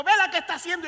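loud high scolding; loud scolding call. Retold or imitated. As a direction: call out to the naughty girl. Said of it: "le decimos".

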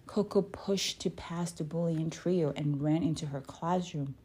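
usual speaking voice

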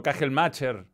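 Kagelmacher as "ka-khel-MA-cher", ch as in chico; Spanish-style Kagelmacher, j first, then "cher"; another wrong pronunciation. Shown as a demonstration. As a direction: misread Kagelmacher with a Spanish ch and final r. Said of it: "o cualquier cosa".

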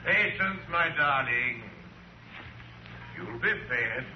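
a quiet, coaxing promise to the dogs; soothing the dogs. Acted. Soothing his hounds.

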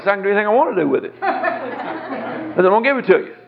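laughing through the words; insist, half laughing; laughing insistence. Retold or imitated. Seller insisting the buyer take the painting, laughing.

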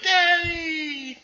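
put-on very high child's call; kids calling. The kids to their father George, a very high, happy call. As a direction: call out like a happy little kid. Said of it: "his kids are like".

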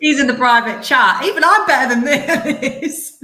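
laughing loudly through words. Real, not acted. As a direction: laugh while teasing the host. Laughing at host's wrong tab.

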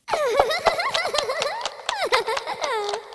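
high wavering ghostly giggle; a creepy doll-like laugh; eerie high laugh. Acted. Unseen presence laughing at the guest, high and eerie.

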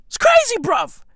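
high-pitched outburst; high exclamation. Acted. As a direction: squeal it in disbelief. Exclaims in disbelief.

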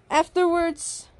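usual speaking voice

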